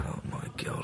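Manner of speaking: whispered, retold awe; hushed disbelief. Flatmate says the letter has arrived, hushed disbelief. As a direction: whisper it as a stunned reaction.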